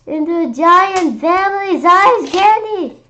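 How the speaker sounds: slow, loud, high marvel; amazed. A narrator's reading. Kid telling how candy grew, slow loud wonder. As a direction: stretch it out in wonder.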